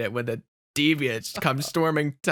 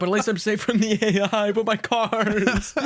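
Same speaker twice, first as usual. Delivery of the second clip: mock lament; 7 semitones higher, 3 dB louder than usual.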